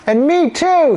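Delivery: high, forceful declaration; mother boldly volunteering herself; defiant mother. Acted. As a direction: join the protest emphatically. Another mother joins the protest.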